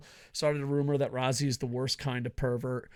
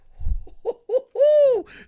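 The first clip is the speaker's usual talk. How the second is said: loud, high hooting laugh; high excited whoop; 9 dB louder than usual. Acted. Fan reacting to Sakuraba's appearance, high excited whoop. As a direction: whoop with over-the-top glee.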